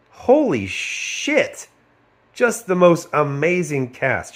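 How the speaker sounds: high, slow, drawn-out amazement; amazed. Real, not acted. Praising the cast, high and slow. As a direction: marvel at it.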